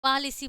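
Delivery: "पॉलिसी" with an open first vowel, PAA-li-see; pronunciation of पॉलिसी. Shown as a demonstration. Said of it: "यहाँ पे क्या पढ़ रहे हैं".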